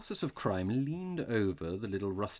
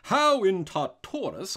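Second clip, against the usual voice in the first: high, loud, drawn-out exclamation; astonished cry; 10 semitones higher, 11 dB louder, slower than usual. A narrator's reading. Robber amazed by what the priest knows, crying out. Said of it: "cried Flambeau".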